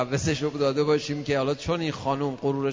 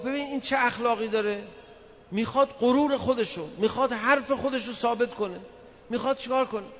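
wife's complaint voiced higher; complaining wife, pitched up; imitating the wife; 6 semitones higher than usual. Wife complaining about her husband to the cleric, higher.

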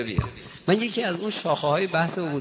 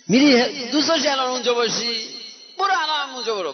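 high urging voice; 8 semitones higher than usual. Retold or imitated. Imam urging companion to go.

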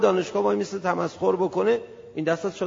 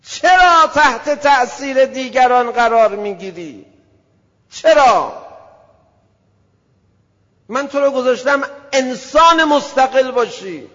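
loud, higher, slow divine reproach; thundering rebuke; 6 semitones higher, 9 dB louder, slower than usual. Acted. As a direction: thunder the why down at him. God rebuking his creature.

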